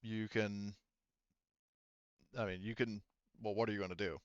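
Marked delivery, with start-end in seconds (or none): none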